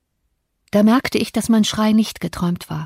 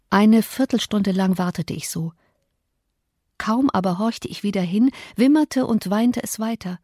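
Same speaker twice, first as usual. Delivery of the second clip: ordinary delivery, nothing marked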